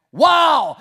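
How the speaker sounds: loud, high sarcastic wow. Real, not acted. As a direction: shout it at the silent room. Reacts to silence after joke.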